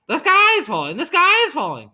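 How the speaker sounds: high-pitched panicked chicken squawk; clucking hen. A narrator's reading. Hen cutting off the narrator's warning, clucking in panic. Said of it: "clucked Chicken Licken".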